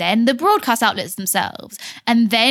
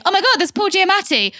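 excited starstruck squeal; 9 semitones higher than usual. Retold or imitated. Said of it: "much of the evening was Tom saying".

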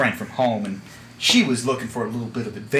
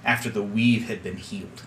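grave storytelling; 2 semitones lower, 5 dB quieter than usual. Acted. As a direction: recount the dark history solemnly. Captain recounts grim history.

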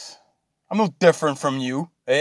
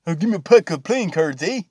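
higher, hammed-up Canadian accent than plain talk; 3 semitones higher than usual. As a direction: put on a cartoonish Canadian twang with "eh". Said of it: "that was a very stereotypical, you know, Canadian accent".

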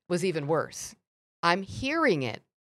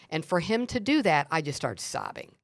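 ordinary talk, nothing marked

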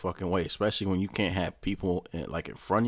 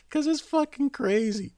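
laughing; 4 dB louder than usual. Real, not acted. Still laughing at Trump.

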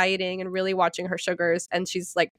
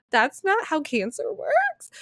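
incredulous; 9 semitones higher, slower than usual. Retold or imitated. Incredulous reply to mom.

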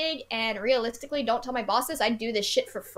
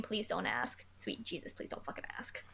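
breathy exasperated whisper; whispered plea; 5 semitones lower, 15 dB quieter than usual. Real, not acted. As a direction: beg under your breath, voiceless.

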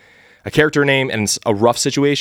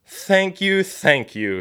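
Mister Jones shouting; 10 semitones higher, much slower than usual. Acted.

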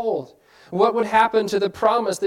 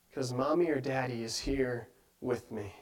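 put-on comforted child; 6 semitones lower, 9 dB quieter than usual.

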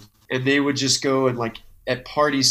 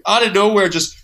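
normal talking voice

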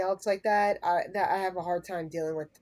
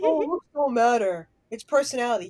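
lightly mocking, louder at the start; mocking quote; 3 semitones higher, 5 dB louder than usual. Dismissing what people say about looks, mocking. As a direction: mimic people's platitude, poking fun at it.

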